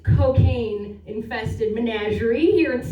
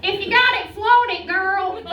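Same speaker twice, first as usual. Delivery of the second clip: high, loud sassy mom voice; imitating mom; 8 semitones higher, 4 dB louder than usual. Comic quoting her mom's motto in a high sassy voice. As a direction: do your mom egging you on. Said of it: "That's exactly what she used to say".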